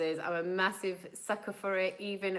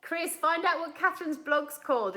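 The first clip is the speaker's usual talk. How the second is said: raised, higher call; 10 semitones higher, 4 dB louder than usual. Real, not acted. Vlogger asks Chris for a blog name, calling out. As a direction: call over to someone off camera.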